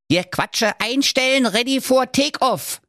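high-pitched little commander; bossy ghost dwarf. Acted. Dwarf ghost readies the miser for flight, bossy. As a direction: announce take-off as a bossy dwarf.

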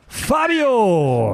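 high ring announcer call-out; brash fight promoter hype. Pretend. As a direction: shout the fighter's name. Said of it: "in der blauen Ecke". (Ringansager ruft den Namen)

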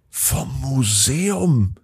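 slow, drawn-out amazed question; astonished. Acted. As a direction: stretch it out in wonder. Jan astonished at museum.

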